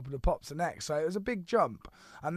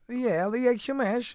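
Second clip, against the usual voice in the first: put-on slow, higher Kazakh Borat accent; 9 semitones higher, 7 dB louder than usual. Showing off his Borat accent to the host. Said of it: "I can do Borat".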